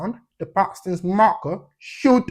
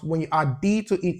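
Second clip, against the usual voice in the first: ordinary talk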